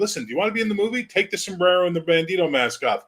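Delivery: high, fast, pushy demand; impatient casting director. Retold or imitated. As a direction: lose patience like an exec. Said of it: "We'd be like".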